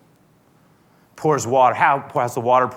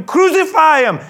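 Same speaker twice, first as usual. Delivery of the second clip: high, full-force shout; crowd yell; 10 dB louder than usual. Acted. The crowd calling to Pilate for execution, high loud yell. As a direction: scream for it as the mob. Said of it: "they yelled".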